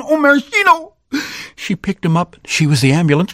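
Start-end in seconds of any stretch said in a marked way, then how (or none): 0.0-1.0 dismayed cry. Acted. Cherry falls and is stepped on.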